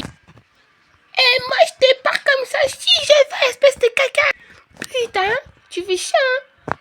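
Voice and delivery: sassing her big sister; bratty toddler. Acted. Sophie talks back. (gamine insolente, voix très aiguë)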